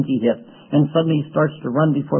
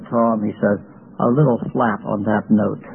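friend's discreet remark in a lower voice; low-voiced correction; 6 semitones lower than usual. Retold friend quietly correcting his singing.

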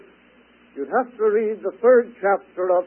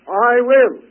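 higher, louder, proclaimed; emphatic proclamation; 6 semitones higher, 9 dB louder than usual. Acted. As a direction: declare it with full conviction. Preacher voicing God's promise.

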